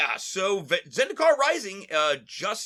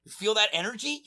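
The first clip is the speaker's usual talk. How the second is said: usual speaking voice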